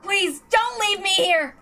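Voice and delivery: a lightly voiced young boy pleading; desperate high plea. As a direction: beg them not to leave you. Pleading not to be left.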